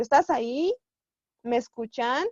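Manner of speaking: "Estás ahí" and "Me escuchan" asked with rising ends; questioning intonation. Shown as a demonstration. Teacher giving examples of questions without question words, questioning intonation. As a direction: ask each as a question, lifting "ahí" and "escuchan". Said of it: "son preguntas".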